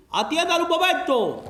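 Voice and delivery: mock police megaphone; megaphone call. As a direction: announce it through a bullhorn. Megaphone announcement outside.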